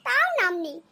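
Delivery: ordinary delivery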